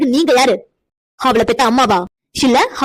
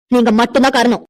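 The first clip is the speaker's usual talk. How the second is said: ordinary talk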